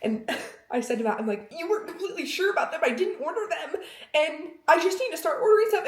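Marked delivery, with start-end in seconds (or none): 1.5-5.8 high whiny. Retold or imitated. Blaming husband, high-pitched.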